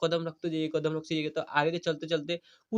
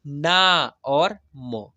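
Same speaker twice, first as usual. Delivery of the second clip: teacher slowly breaking a word into its sounds; slow sounding-out; 4 dB louder, much slower than usual. Shown as a demonstration. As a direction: draw out ना, then give म separately. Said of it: "तो इसको पढ़ेंगे". (ना और म को अलग-अलग, धीरे बोलना)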